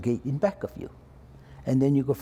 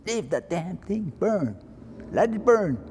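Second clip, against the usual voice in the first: slow, higher-pitched drawn-out command; imitated firm order; 6 semitones higher, slower than usual. Chief refusing to put out the fire, imitated slow order. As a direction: draw out the order, letting pitch climb.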